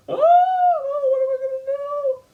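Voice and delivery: very high falsetto panic, mock helpless; damsel in distress. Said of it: "So he's like".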